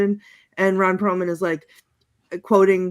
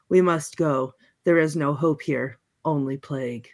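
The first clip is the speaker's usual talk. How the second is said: meniru Nick Cage dengan suara sedikit lebih rendah dan muram; dark and serious; 6 semitones lower than usual. Retold or imitated. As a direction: ucapkan dengan serius dan kelam, tapi tetap ringan. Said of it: "Nick Cage says very, very darkly and seriously".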